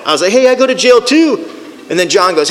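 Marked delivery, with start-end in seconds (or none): 0.3-1.5 much higher, eager me-too; acted-out eager agreement; excited chiming in. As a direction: jump in delighted to belong. Excitedly joining the men's confessions.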